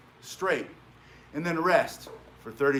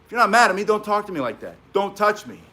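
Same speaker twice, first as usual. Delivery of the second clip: firm and direct; 5 semitones higher, 5 dB louder than usual. Shown as a demonstration. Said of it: "or you say".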